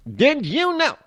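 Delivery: high, put-on TV spy voice; Get Smart catchphrase. Host quoting an old TV spy show, in a high put-on voice. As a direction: chirp it like a sitcom spy.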